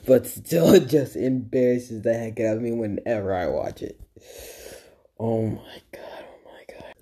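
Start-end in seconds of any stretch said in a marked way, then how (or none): 5.2-6.9 whisper. Real, not acted. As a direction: whisper under your breath. Cringing at his old video.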